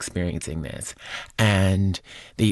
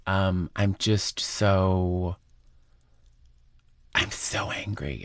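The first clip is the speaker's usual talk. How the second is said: choked-up anger; slower than usual. Real, not acted. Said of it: "I'm emotional, I'm angry".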